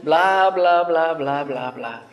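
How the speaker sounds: put-on cheeky childish blah-blah, trailing off; mocking child. Child mocking the adult who scolds, cheeky blah-blah. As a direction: sass back at whoever is scolding. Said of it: "le empiezan a decir a quien lo está regañando".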